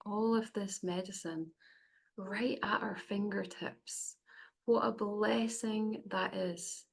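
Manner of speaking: unhurried meditation voice with long pauses; guided meditation. Acted. Facilitator guiding the group to close their eyes, guided meditation. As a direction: ease them gently inward, phrase by phrase. Leads a cacao ceremony meditation.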